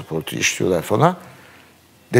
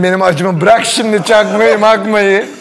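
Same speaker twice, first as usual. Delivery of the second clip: loud, higher, exasperated retort; agitated protest; 7 semitones higher, 4 dB louder than usual. Retold or imitated. Patient snapping at the dentist about his pain, loud exasperated protest. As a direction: wave the topic away in mock outrage. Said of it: "Tamam, sakin".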